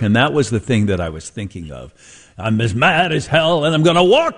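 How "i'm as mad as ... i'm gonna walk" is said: lightly voiced angry rant, rising; a hint of a movie tirade; Howard Beale rant. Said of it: "Howard Beale ... that monologue".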